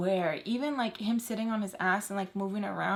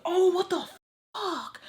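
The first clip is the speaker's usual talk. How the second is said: high-pitched imitated yelp of protest; startled mock exclamation, pitched way up; shocked outcry; 10 semitones higher, 5 dB louder than usual. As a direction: jump high, as if just smacked. Acts him hit by pillow.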